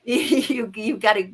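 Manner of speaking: usual speaking voice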